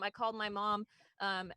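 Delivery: ordinary talk, nothing marked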